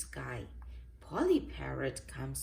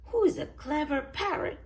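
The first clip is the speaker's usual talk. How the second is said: high, loud parrot echo; 9 semitones higher, 6 dB louder, faster than usual. A narrator's reading. Polly repeats Granny Pig's words, parrot voice. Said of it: "says Polly".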